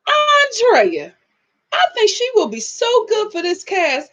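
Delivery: high, eager mom voice; imitating mom. Vlogger voices her mom pitching Andrea, high and eager. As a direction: gush like mom suggesting a cast pick. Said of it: "My mom gonna say".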